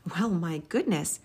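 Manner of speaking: plain reading voice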